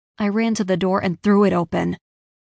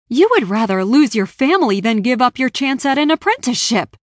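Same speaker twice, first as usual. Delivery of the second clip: loud, raised-pitch tirade; raging; 4 semitones higher, 6 dB louder than usual. A narrator's reading. Stranger berating Raya over an apprenticeship, raging. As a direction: rail at them in angry disbelief. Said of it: "the stranger raged".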